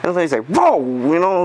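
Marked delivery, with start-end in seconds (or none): 0.5-0.8 high, loud startled gasp of a word; startled whoa. Retold or imitated. Bystander lady caught off guard, startled whoa. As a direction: jump back in surprise.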